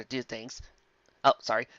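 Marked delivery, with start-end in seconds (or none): none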